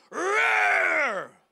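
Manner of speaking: long, loud shouted roar; lion roar. Retold or imitated. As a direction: roar harder to frighten the little one. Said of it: "lalong nag-roar".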